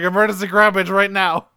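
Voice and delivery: hammed-up emergency call; mock urgent call. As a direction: call urgently for rescue as Starscream. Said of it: "immediately calls for an evac".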